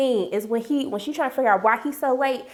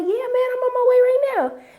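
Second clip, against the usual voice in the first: high-pitched, raised imitation of a phone reply; mock phone answer; 11 semitones higher, 4 dB louder than usual. He answers a phone call while heading over, high-pitched mock reply.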